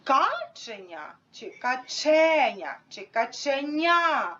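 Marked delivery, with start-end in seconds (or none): 0.0-1.1 КАченя, first syllable slow and stretched; «каченя» with a long, stressed КА. Shown as a demonstration. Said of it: "Де знаходиться наголос?". 1.5-2.8 каЧЕня, middle syllable drawn out; slow «каченя» with a long, stressed ЧЕ. Shown as a demonstration. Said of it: "Де знаходиться наголос?". 3.1-4.4 качеНЯ, final syllable drawn out; slow «каченя» with a long, stressed НЯ; stress on last syllable. Shown as a demonstration. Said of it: "Де знаходиться наголос?".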